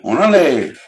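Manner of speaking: hammed-up Chicano accent. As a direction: talk like a laid-back Chicano homie. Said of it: "Chicano of SoCal".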